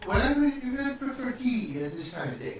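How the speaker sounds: high, sweet motherly voice; Lydia concerned. Acted. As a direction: coo over the sick boy. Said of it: "She says".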